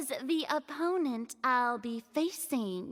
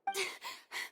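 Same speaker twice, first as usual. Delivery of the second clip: quick unvoiced chuckle; a short airy laugh to herself; soft breathy laugh; 10 dB quieter than usual. Furina chuckles before agreeing with the officer, airy laugh.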